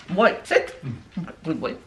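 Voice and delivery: usual speaking voice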